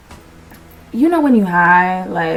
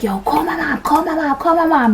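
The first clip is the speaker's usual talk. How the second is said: urgent pleading; 7 semitones higher than usual. Retold or imitated. Arrested date urgently begging.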